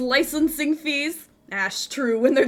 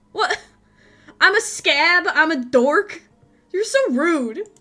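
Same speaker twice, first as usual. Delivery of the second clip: offended indignation; 5 semitones higher, 4 dB louder than usual. Acted. Offended at insults.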